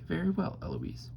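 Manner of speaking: high echo falling away on the name; Eloise mimicking. A narrator's reading. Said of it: "I stand up and say".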